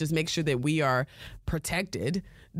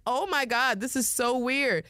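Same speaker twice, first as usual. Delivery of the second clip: higher-pitched retold astonishment; amazed reaction; 7 semitones higher than usual. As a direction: replay your own amused disbelief. Retells own reaction to bizarre video.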